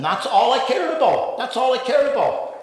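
loud, high-pitched exclamation; emphatic admission. Real, not acted. Emphatic confession of drinking obsession.

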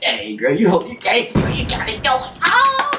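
hammed-up pickup line climbing high; mock flirting. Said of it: "I'm like".

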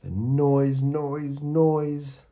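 climbing exasperated cry of noise; rising noisy outcry. A narrator's reading. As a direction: build it up like a headache of racket. Narrator mimics hated racket.

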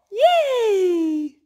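high, loud yay; excited cheer. Real, not acted. Mother cheering with her boys after the book, loud and excited.

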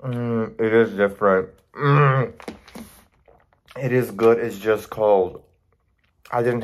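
talking while chewing food; muffled words between bites; mouth full, chewing. Real, not acted. Seen 0: Took a forkful at -3; closed, working mouth while speaking.

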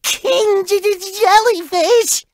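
high, slow nervous stammer; laughing-crying nervous stutter; stammering dread. Acted. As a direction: choke on the word in fear. Dreading the king jellyfish.

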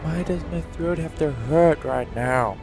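ordinary delivery

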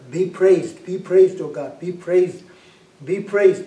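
loud re-enacted praise cries; crying out to God in a retold prayer; fervent praise. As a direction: punch out each cry of praise loudly.

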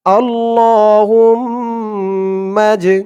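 আল্লাহুম্মা drawn out very slowly with long hold; slow recitation. Shown as a demonstration. Teacher modelling pronunciation and elongation, slow recitation. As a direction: intone আল্লাহুম্মা with a long stretch. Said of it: "তেমন উচ্চারণ করবেন".

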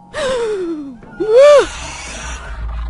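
sharp gasp, pitched high; loud startled gasp. Real, not acted. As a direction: gasp in sudden fright. Jump scare by monster.

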